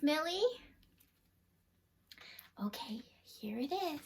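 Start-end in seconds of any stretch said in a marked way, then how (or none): 2.6-4.0 very soft conspiratorial whisper. Acted. Revealing Millie's bag to the children, whispered. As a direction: let the children in on the secret.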